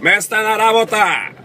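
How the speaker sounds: high-pitched shout from the next table; heckler's yell. Acted. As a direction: yell the old joke across the hall. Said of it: "that yells out".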